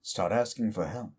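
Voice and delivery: lightly voiced demon villain, mocking and smug; Rabal taunting. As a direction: sneer at the listener like a smug demon, only lightly put on.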